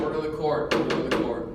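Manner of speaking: loud commanding gavel call; judge calling order. Acted. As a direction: shout for order in court.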